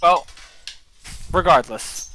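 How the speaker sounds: normal talking voice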